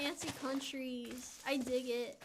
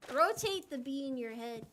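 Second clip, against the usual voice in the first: normal talking voice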